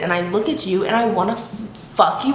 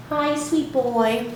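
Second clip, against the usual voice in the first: slow, higher cooing to a cat; pet talk; 4 semitones higher, much slower than usual. Real, not acted. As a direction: coo a tender hello to your cat. Greets her cat.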